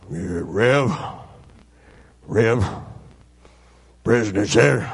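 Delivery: low-voiced foreman, halting between phrases; imitating gruff foreman. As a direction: lightly voice a hesitant workman, low. Voicing the foreman's awkward request.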